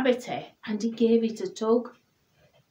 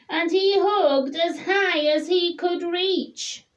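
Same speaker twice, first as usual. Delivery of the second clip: raised pitch, held high; high step; 7 semitones higher than usual. A narrator's reading. Narrator reaching high up the tree, voice high. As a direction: stretch the voice up to the top.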